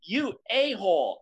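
imitating an indignant send-off; telling her off. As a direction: tell her off, drawing out each word. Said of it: "like, tells her off and leaves".

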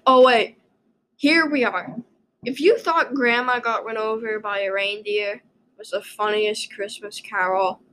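ordinary delivery

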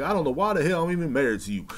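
fast, high imitated griping; quick-fire retold squabble; mock bickering. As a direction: rattle off a husband's mock complaint. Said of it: "they just going back and forth".

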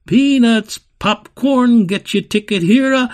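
high, loud barker's calls; carnival barker shout. Acted. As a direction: hawk it to the crowd. Barkers calling again.

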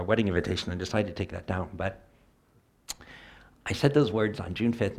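ordinary delivery

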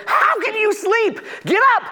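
frantic captain shouting over a storm; urgent ship captain. Acted. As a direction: rouse the sleeper in panic. Captain wakes Jonah in storm.

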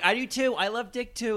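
high, eager chiming in; Dina. Retold or imitated. Mimicking a housemate eagerly agreeing, high and excited. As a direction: pipe up in an excited mock-girlish pitch.

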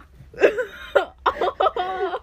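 high, loud eerie laugh; a strange spooky burst of laughter; creepy laugh. Real, not acted. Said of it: "das hat sich eben gruselig, komisch angehört".

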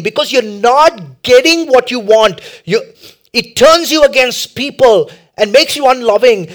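high, loud, climbing sermon cry; rising pulpit fervour; full-force preaching. Acted. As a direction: preach it hard to the congregation.

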